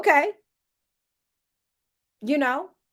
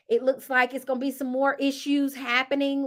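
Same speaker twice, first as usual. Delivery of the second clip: normal talking voice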